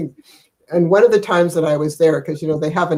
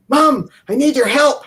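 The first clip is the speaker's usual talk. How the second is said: higher pleading son voice; son's plea; 9 semitones higher, 4 dB louder than usual. Retold or imitated. Grandmother retelling her son's phone plea, higher. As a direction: mimic the son begging for help. Son calling in distress, retold.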